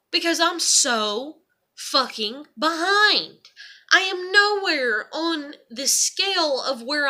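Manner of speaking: slow, loud, upset emphasis; frustrated outburst. Real, not acted. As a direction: hammer home how far behind. Frustrated outburst about school.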